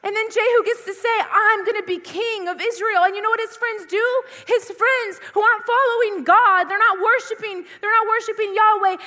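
rousing youth-rally preaching. Acted. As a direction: hammer each line home to the youth. Preaches at full force.

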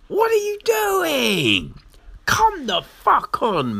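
squeaky high outraged whine; exasperated disbelief. Retold or imitated. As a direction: plead in comic despair. Exasperated reaction to character's stupidity.

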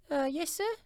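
put-on very high girlish voice; squeaky, polite maid; timid servant girl. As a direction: answer as a little housemaid. Maid answers Scrooge at the door.

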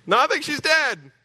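high, sarcastic retort; sarcastic mourner. Retold or imitated. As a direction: scoff it back at Jesus. Mourners mock Jesus.

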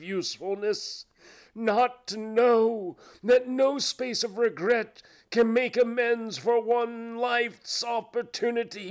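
high, loud ghostly lament; anguished cry. Acted. Phantom bewails its misused life to Scrooge, crying out. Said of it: "cried the phantom".

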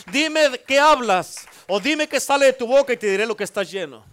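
loud, rising preacher's cry, each line hammered; fiery preaching. Acted. Preacher rousing the congregation to sing, loud and fervent. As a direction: preach it to the back row with full force. Building sermon climax.

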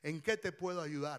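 soft, low offer of help; gentle concern. Acted. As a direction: offer comfort quietly and tenderly. Caring husband offers help.

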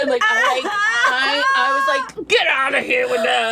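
high shouted babble; crazy drunk rant. Retold or imitated. Drunk woman ranting at a neighbor, high shouting. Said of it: "talking like a crazy person".

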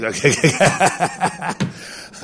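long rattling laugh in bursts; laughter. Real, not acted. Laughs at his own joke. (צחוק מתגלגל)

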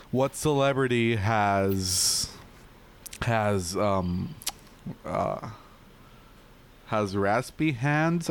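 drawn-out joke question; slow halting setup. Acted. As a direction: stretch out the setup.

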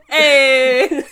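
laughing shout that clips the mic; clipping distorted mic. Real, not acted. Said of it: "Oh, fuck, I clipped the mic".

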